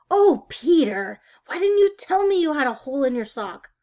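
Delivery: a fussing mother, caught off guard; dismayed mother. Acted. Mother scolds about sock hole.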